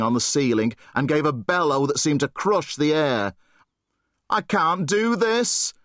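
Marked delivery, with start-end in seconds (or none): 4.3-5.7 panicked cry. Acted. Boy faces charging monster.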